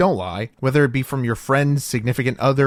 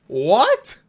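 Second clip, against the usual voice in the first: higher, rising amazed question; amazed what; 8 semitones higher than usual. Shown as a demonstration. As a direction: sound impressed and stunned. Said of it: "what about this one".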